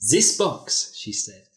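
steady storytelling read; reading a racy story aloud to friends; story narration. Acted. Reads erotic story aloud.

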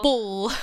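bull with a long, heavy final L; emphasis on L. Retold or imitated. Imitating boyfriend's dad, over-emphasising the L. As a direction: hold the L at the end of bull. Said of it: "put so much emphasis on the L".

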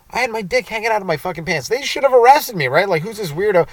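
normal talking voice

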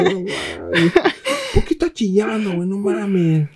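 un toque de voz aguda burlona; burla. Retold or imitated. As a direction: remeda al hermano. Imita al hermano. (remedando levemente al hermano burlón)

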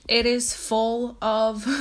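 ordinary talk

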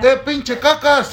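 high, drawn-out teasing call; mock shout. Co-host imitates the friend yelling the nickname, mock shout. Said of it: "Y le gritó".

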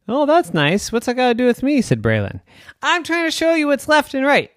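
squeaky sing-song banter; high childlike voices. Acted. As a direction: play it high and bright. Girl and walrus banter.

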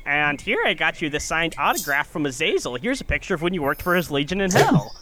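a half-hearted stab at an eager interviewer; Nardwuar voice. Retold or imitated. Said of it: "That's not a good Nardwuar voice".

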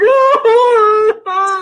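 high loud mock sob; mock wail. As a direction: wail like a grown man crying. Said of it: "It's not Hank's like".

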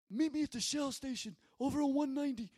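high, quick mafia-guy impression; Paul. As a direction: rattle it off as a put-on New Yorker. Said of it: "this is how he talks".

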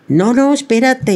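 high, eager grandma interrupting; grandmother voice. Retold or imitated. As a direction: stop her gently, with a secret. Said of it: "grandmother stops the child". (voz de abuela entusiasmada)

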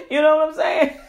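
high, quick words through laughter; amused, breaking into a loud laugh. Real, not acted. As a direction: laugh at yourself as you say it. Laughing at own crying story.

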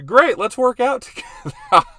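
very high, loud excited imitation; hammed-up eager wife, breaking into laughter; mock enthusiasm. Husband mimicking his wife agreeing to work out, over-excited. Acting out wife's enthusiastic reply.

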